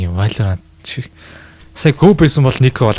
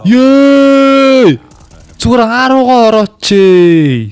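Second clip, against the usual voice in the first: high, slow excited yell; excited shout; 11 semitones higher, 4 dB louder, much slower than usual. Real, not acted. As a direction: cry out at the play. Co-caster reacts to play.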